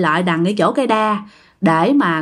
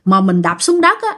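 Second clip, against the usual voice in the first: plain reading voice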